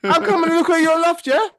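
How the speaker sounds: high, loud, shouted street slang voice; super street. Pretend. Imagining out-weirding the homeowner, loud mock street voice. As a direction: bark it like a cocky roadman. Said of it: "I should have gone s-, super street".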